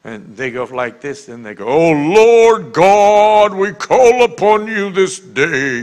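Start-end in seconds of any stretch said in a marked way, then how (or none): 1.7-5.8 much higher, much louder, very slow pompous prayer voice; mocking preacher prayer voice. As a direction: ham up a preacher intoning to the Lord. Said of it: "their voice changes".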